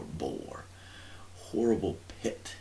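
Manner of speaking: ordinary delivery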